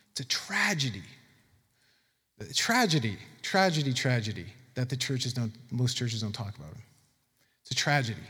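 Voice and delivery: soft, subdued regret; hushed lament. Real, not acted. Preacher laments what most churches don't talk about, hushed lament. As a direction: drop the volume and let the repeats sink.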